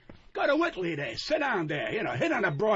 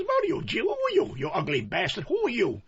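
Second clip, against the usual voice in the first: retold indignant challenge; 3 semitones higher than usual. Comic telling off the man who dropped him, indignant. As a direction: demand it indignantly, pitch leaping way up. Retells his angry confrontation.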